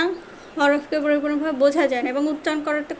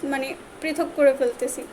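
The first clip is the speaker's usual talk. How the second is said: usual speaking voice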